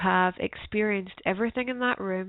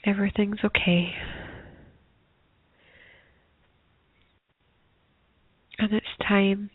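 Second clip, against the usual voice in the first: guided meditation. Acted. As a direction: ease them gently into stillness.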